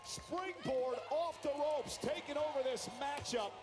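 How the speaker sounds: loud, high, drawn-out play-by-play call; excited commentary. Real, not acted. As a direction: belt out the big move. Big move call on live commentary.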